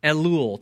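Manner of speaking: "Elul" said slowly, eh-LOOL, stress on the last syllable; Hebrew word demo. Shown as a demonstration. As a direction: model "Elul" for the class to repeat. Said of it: "Try it out with me".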